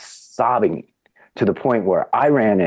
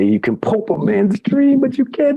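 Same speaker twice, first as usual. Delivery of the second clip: rising, much higher dramatic declamation; dramatic speech. Retold or imitated. Retelling Avery's scene, much higher mock-dramatic delivery. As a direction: hammer out the firing speech. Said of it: "he does the whole thing".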